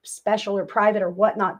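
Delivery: ordinary talk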